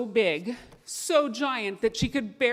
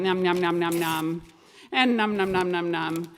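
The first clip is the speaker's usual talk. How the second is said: eating noises; 3 semitones lower than usual. Acted. Fox nibbles the cheese.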